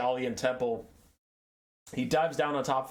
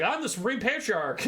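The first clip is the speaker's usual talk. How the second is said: proud announcement; 9 semitones higher than usual. Retold or imitated. Patriarch proudly announces himself.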